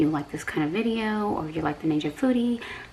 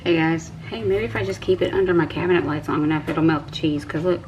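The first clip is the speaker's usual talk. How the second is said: usual speaking voice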